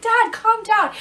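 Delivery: pleading daughter. Retold or imitated. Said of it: "I'm like".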